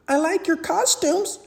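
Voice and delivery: put-on high, small child's voice; little girl voice. A narrator's reading. Small monster girl to Mike and Sully, high and sweet. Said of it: "she said".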